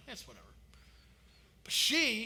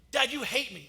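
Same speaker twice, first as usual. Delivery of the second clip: put-on sulky child; 5 semitones higher, 6 dB louder than usual. Child sulking example.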